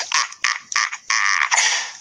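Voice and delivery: a big booming Viking laugh; hearty laugh. A narrator's reading. Gobber enjoying his little joke, hearty laugh. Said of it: "Gobber laughed heartily".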